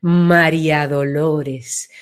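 slow and low, the name spreading wide; broad, expanded name. Shown as a demonstration. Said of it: "se ensancha".

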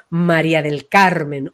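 "María del Carmen" drawn out slowly and low; grand, slow name. Shown as a demonstration. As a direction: give the name room, slow and deep. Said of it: "ocupar un lugar grande".

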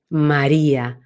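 MARÍA low and deep, placed behind; back resonance. Shown as a demonstration. Coach demonstrates names with depth, placed low. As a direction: let María sink to the back of the mouth. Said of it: "Pueden probar cómo está detrás María".

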